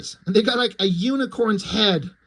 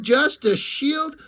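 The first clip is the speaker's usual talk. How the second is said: "just a shield" much higher and slow, stretched out in mock hurt; drawn-out wounded echo; 8 semitones higher, much slower than usual. The shield sulks back at Dork, high and drawn out. Shield repeats Dork's insult, offended.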